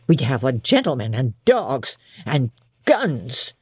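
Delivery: the servant turning a vagrant away, stern; stern old servant. Acted. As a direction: brush off the beggar firmly. Hannah threatens and refuses.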